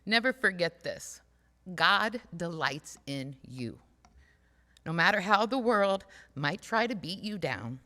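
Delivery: impassioned preaching. Real, not acted. Preacher sends the congregation off with full-force encouragement. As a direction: preach it out to lift them up.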